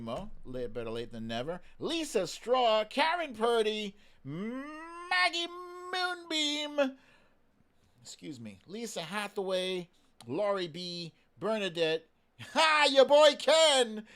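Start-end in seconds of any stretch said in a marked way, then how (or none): 4.2-7.0 high, floaty reading of the name; a light, high, dreamy lilt. Acted. 12.5-14.0 loud, high, slower hype call; big hype shout-out to a friend; excited shout-out. Real, not acted.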